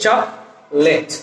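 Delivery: চকলেট in two slow parts, chok-let; correct pronunciation. Shown as a demonstration. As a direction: drop the middle o, slowly saying চকলেট. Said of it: "উচ্চারণটা হচ্ছে কি". (শুদ্ধ উচ্চারণে ধীরে চকলেট, "চক-লেট")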